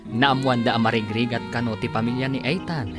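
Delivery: ordinary talk, nothing marked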